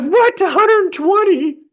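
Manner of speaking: high, loud "what?!" at the bill; shocked disbelief. Retold or imitated. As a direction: react to an outrageous price. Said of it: "And I'm like".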